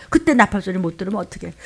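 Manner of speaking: normal talking voice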